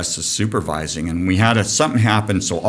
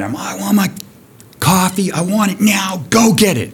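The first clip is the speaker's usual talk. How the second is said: raised, higher retold yell for coffee; half-acted angry demand, pitched up; retold screaming; 10 semitones higher than usual. Furious boss yelling at the new tender for coffee, mock yell. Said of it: "I was screaming".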